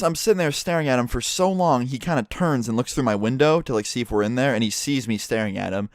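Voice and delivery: ordinary talk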